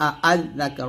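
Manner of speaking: usual speaking voice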